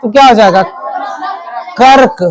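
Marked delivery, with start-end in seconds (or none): 1.8-2.2 "कर्क", full KA then a light r into k; one clear syllable "कर्क"; light half r. Shown as a demonstration. Teacher joins the sounds for class, "कर्क" with light r. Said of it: "रो का हल्का उच्चारण करना है".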